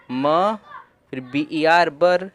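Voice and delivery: ordinary delivery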